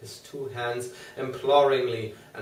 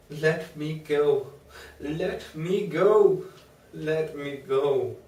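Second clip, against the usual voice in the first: slow, higher repeated begging; desperate pleading; 4 semitones higher, 4 dB louder, slower than usual. A narrator's reading. Patient begging the doctor to free him, slow desperate pleas. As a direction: plead each "let me go" slowly. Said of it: "poured forth a torrent of entreaty".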